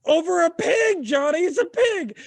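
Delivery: very high, loud cry over a pig; incredulous exclaim. Real, not acted. Host exclaiming to Johnny about the pig, incredulous. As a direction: shout it in mock outrage.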